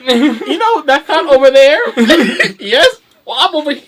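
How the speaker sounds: real laughter breaking up the lines; laughing through words. Real, not acted. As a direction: crack up mid-joke. Laughing through superhero bit.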